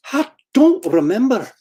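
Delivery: put-on high-pitched, protesting Scottish accent; Scotty impersonation. Scotty answering whether he killed that girl, high Scottish impersonation. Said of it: "and a Scotty impersonation".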